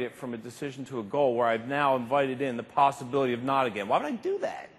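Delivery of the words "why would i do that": high, unbelieving squeak of a question; incredulous. Real, not acted. Speaker asking the audience, high and incredulous. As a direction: sound baffled by your own choice.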